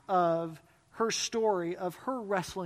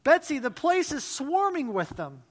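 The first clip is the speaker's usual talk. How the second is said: alarmed; 6 semitones higher, 7 dB louder than usual. A narrator's reading. Corrie alarmed at fleas.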